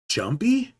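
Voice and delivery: high rising Jumpy?; high questioning. Acted. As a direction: ask Jumpy? with a high lift. Word echoed twice.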